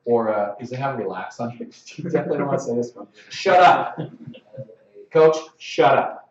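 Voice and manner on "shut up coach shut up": ref loudly shuts down a coach, exasperated; loud, forceful mock command; barked shut-up. Shown as a demonstration. Showing refs what never to say, loud mock shut-up.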